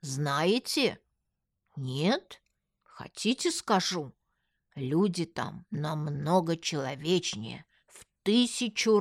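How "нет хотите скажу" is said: ordinary delivery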